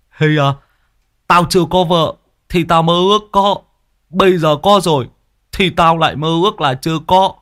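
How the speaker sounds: put-on high, older man's rueful voice; uncle's grumbling reply. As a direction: lament married life half-joking. Uncle answers the boy.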